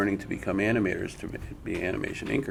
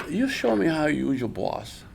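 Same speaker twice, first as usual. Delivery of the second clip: higher imitated Japanese accent; 8 semitones higher than usual. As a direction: mimic the teacher's challenge in a Japanese accent. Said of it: "imitating an accent".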